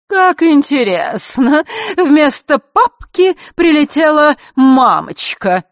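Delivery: high, loud, slow singsong taunt; mocking singsong. A narrator's reading. Elena taunts her new opponent fearlessly, in a singsong. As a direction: sing it out with exaggerated interest. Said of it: "пропела Елена".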